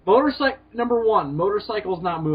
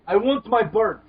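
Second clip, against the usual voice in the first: hammy mock Russian, a bit higher and louder; bad Russian accent; 4 semitones higher, 4 dB louder than usual. Bill quoting Iron Man Two in a bad Russian accent. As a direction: ham up a Russian villain's line. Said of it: "Bill's Russian accent is so bad".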